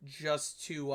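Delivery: ordinary talk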